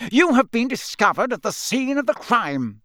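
high, swooping, wrathful pronouncement; mad accusation. A narrator's reading. Crouch with popping eyes accusing the boys, raving. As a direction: accuse like a half-mad prosecutor. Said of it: "He looked slightly mad".

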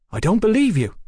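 high-pitched disbelief; incredulous. A narrator's reading. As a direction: object in open disbelief, voice swooping up. Brian rejects Fraser's claim.

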